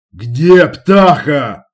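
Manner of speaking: loud, slow menacing bark; terrifying voice. A narrator's reading. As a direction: bark it to frighten her. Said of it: "Страшным голосом гаркнул я".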